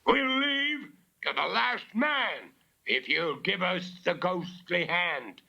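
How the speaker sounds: high gruff robber calling up; robber's shout. Acted. Robber leader shouting up to the house, high demanding call. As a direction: bellow a demand for the hand. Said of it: "Bella heard the familiar voice shout".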